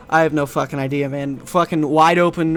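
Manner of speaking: normal talking voice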